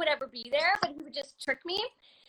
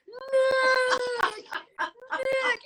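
high, long-stretched movie slow-mo cry; drawn-out high slow-mo holler; slow-motion shout; 9 semitones higher, 4 dB louder than usual. Retold or imitated. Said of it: "like, slow-mo".

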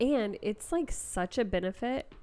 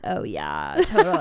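put-on vocal-fry podcast girl, louder; 7 semitones higher, 8 dB louder than usual. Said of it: "I sound like one of those podcast girls".

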